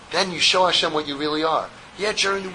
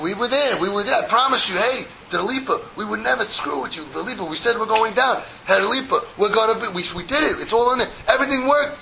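higher-pitched, lightly acted protesting workers; mock pleading; 6 semitones higher than usual. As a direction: insist and plead like workers defending themselves. Workers pleading their case to boss.

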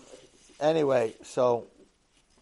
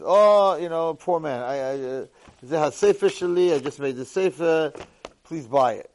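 pleading seller; 4 semitones higher, 8 dB louder than usual. Retold or imitated. Poor man pleading to sell sefer.

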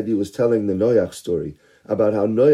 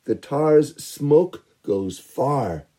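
ordinary delivery

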